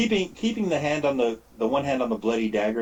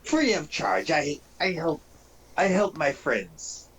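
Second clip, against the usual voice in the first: higher-pitched goblin voice in broken English; swaggering goblin; 4 semitones higher, slower than usual. Acted. Goblin demanding payment for a potion, higher goblin voice. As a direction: play a haggling goblin, pitched up. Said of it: "He goes".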